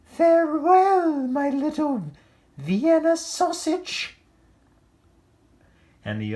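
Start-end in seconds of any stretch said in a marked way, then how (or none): 0.0-4.2 very high, loud, slow mother's voice; gushing mom waving goodbye, high and drawn out; doting mother calling out. Acted. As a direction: sing it out across the yard to her pup. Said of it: "waving and calling out".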